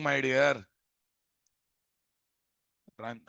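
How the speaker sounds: normal talking voice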